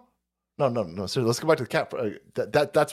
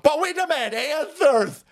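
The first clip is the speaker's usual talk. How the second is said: mock demand; 9 semitones higher, slower than usual.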